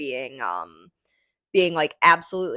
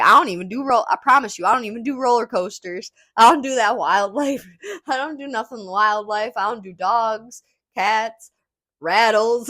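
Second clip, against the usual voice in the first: higher, louder, excited retelling of the interviewee; doing the guy from the news interview, higher and louder; imitating Curtis; 5 semitones higher, 5 dB louder than usual. Podcaster mimics news interviewee in high, loud voice. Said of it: "He was like".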